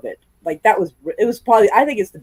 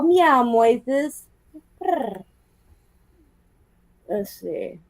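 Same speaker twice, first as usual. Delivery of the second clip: ordinary delivery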